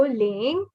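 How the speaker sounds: "ling" sliding from low up to high; rising tone. Shown as a demonstration. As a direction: let the pitch rise on "ling". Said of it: "you go from low to high".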